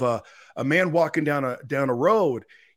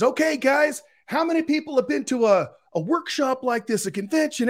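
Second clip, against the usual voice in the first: imitating Gary V shouting; 11 semitones higher than usual. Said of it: "And he says".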